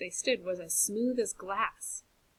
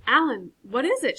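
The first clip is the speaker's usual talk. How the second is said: louder, higher call; shouting; 4 semitones higher, 7 dB louder than usual. Acted. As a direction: shout into the dark. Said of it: "shouted Marley".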